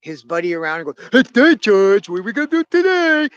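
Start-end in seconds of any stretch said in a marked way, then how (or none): 1.1-3.3 loud, high, uncontrolled holler; big dumb cartoon sidekick. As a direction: holler it with no control of your volume. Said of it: "He doesn't know how to control his voice level".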